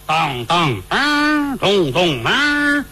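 drawn-out mimicked gong strokes; slow vocal gong imitation; imitated gong beats. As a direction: sound out the gong, letting each stroke ring.

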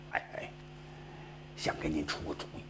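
quick hushed whisper; secret whisper. Acted. Scholar whispering a secret offer to the powerful minister, hushed. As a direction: drop to a whisper to share a secret.

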